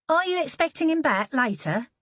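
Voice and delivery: Holly asking the neighbour; Holly's voice. Acted. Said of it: "Holly questions the neighbour".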